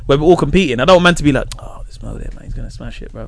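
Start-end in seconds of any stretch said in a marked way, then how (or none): 1.6-3.3 hushed mock gossip between rival comics; imitated whisper. Rival comics whispering about Mo, hushed imitation. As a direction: whisper it like rivals muttering backstage. Competitors muttering about him backstage.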